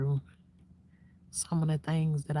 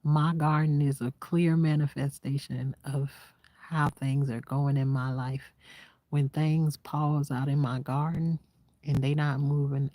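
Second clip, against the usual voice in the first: ordinary talk, nothing marked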